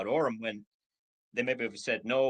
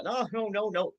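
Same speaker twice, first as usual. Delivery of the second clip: high, rapid string of no's; quick refusal; 9 semitones higher, 4 dB louder than usual. Retold or imitated. Prospect waving off a business pitch, high and quick. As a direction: brush the pitch off fast, higher up. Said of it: "they go".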